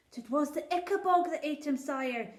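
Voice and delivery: a poor shepherd pleading before his king; rustic old shepherd. Acted.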